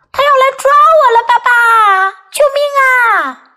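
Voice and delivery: high, loud pretend cry for help; acted child's alarm; yelling. A narrator's reading. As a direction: shout in mock panic. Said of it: "他大喊道".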